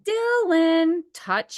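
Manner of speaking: high, short sing-song instruction; sing-songy therapist voice. Retold or imitated. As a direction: talk down to a child in sing-song. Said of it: "short sing-songy voice".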